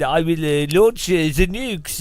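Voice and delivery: lightly put-on French accent, slow on launch; a hint of a French villain; mock French accent. Streamer mocking Putin as a French villain, mild French accent. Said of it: "French Putin".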